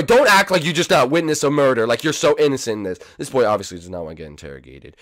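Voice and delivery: usual speaking voice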